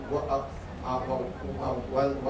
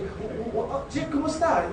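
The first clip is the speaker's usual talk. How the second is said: stuttering; 10 semitones higher than usual. Retold or imitated. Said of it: "I feel so embarrassed".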